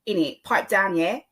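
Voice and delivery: matey put-on Cockney; Cockney accent. Shown as a demonstration. Podcaster showing once commonplace talk, Cockney accent. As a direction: slip into Cockney with a questioning tag. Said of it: "it used to be very commonplace".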